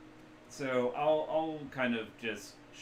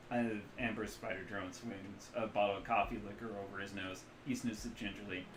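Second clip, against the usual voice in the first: ordinary talk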